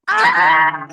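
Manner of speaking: loud anguished scream of shock; anguished yell. Retold or imitated. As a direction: let out a big anguished "Ah!". Reacting to being outed.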